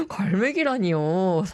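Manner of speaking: laughing through mock hurt; half-laugh, half-whine it out; host laughing at a listener's teasing comment. Real, not acted. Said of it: "더 상처받았는데".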